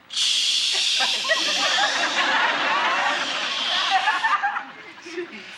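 high buzzing of a fly in bug spray; lazy fly buzz. Acted.

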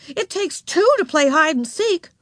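high, cross correction; snappish. A narrator's reading. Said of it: "snapped Beezus".

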